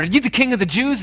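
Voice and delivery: high, fast flustered question; flustered Pilate. Acted. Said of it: "he's flustered".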